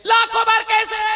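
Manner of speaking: high, scoffing mimic of a critic; mock incredulous objector; mocking question. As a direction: ape the doubters' whining question.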